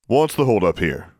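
a hint of a stern man; demanding dean. A narrator's reading. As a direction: demand it with authority. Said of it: "demanded an assistant dean".

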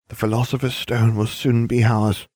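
ordinary delivery

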